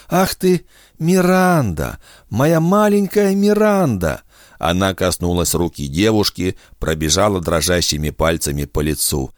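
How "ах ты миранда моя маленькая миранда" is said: put-on high, slow delighted old voice; overjoyed grandmother. A narrator's reading. Grandmother greets her grown granddaughter, slow and high with joy. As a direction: stretch it out with tender joy.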